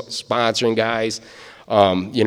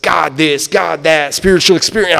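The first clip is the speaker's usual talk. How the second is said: higher, louder mock of the sponsor's spiritual talk; imitating an over-eager sponsor raving about God; gushing sponsor; 6 semitones higher, 5 dB louder than usual. Sponsor gushing to the DOT lady, higher and louder. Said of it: "and he's like".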